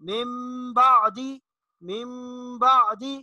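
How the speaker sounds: मिन बादी slow, न turned to humming म; correct iqlab. Shown as a demonstration. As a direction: draw out मिन बादी as मिम बादी. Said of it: "बल्कि इसको पढ़ेंगे". (मिन बादी को मीम और गुन्ना से)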